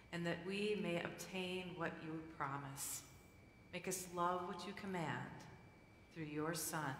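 low, soft, measured prayer; formal prayer. Real, not acted. Pastor praying before the congregation, soft and solemn. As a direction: lead the congregation quietly in prayer.

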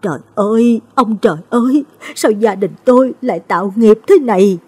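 put-on an old woman crying out in grief; wailing lament. A narrator's reading. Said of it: "Bà ôm mặt gào lên".

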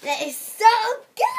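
ordinary talk

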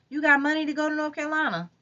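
high, fast sarcastic jab; high fast sarcastic question. A narrator's reading. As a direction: needle her with disbelief. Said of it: "asked sarcastically".

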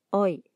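oi as one gliding "oy" sound; oi as a single diphthong; German eu sound. Shown as a demonstration. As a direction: say oi like "oy" in "boy". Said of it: "Esa combinación se pronuncia".

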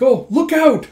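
very high, loud warning cry; urgent shout. Acted. Girl warning her friend of the slash, a high loud shout. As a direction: yell to push her clear.

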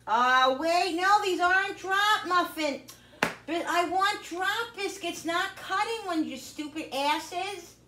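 fed up with the wrong recipe; frustrated rant. Real, not acted.

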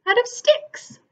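high squeaky little pig voice; little pig speaking brightly. A narrator's reading. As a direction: lift the voice up into a small piglet.

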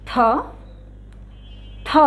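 থ repeated with a puff of breath; teacher drilling থ; aspirated tho drill. Shown as a demonstration. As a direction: drill থ, breathy push each time. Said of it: "দুই একবার এটা উচ্চারণটা করি".

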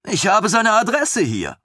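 a proud lieutenant, beaming. Acted.

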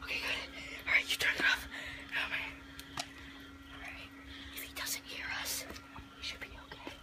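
very soft hiding whisper; whisper, keep out of earshot. Pretend. Hiding from the skeleton with a friend, soft whisper.

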